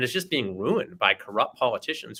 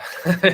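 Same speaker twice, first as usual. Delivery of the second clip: short laugh; 8 semitones higher, 6 dB louder than usual. Real, not acted. Guest laughs at question.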